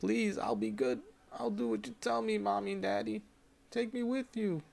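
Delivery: put-on high, pleading little girl's voice; cling to them, begging like a scared child. Hallucinating girl begs her parents.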